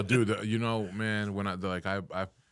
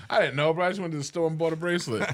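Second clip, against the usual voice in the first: higher and louder, protesting innocence; defensive protest; 8 semitones higher, 3 dB louder than usual. Retold or imitated. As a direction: plead it up high, caught out.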